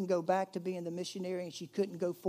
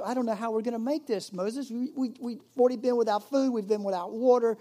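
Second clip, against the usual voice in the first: acted worried grumbling, quick and higher; mock complaint; 5 semitones higher, 6 dB louder than usual. Israelites complaining to Moses about no food or water, acted fretful complaint. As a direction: complain anxiously as one of the crowd.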